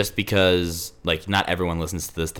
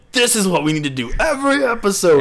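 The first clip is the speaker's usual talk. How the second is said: excited; 11 semitones higher, 5 dB louder than usual. Retold or imitated. Retelling their excited plan.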